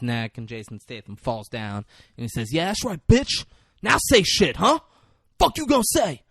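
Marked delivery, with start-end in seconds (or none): 2.5-6.2 high, loud mock taunt; taunting gloat. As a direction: crow over a beaten enemy. Acted gloating over a downed foe.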